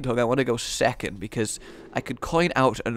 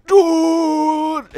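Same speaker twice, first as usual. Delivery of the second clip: mock shout; 10 dB louder than usual. Mock reaction noise.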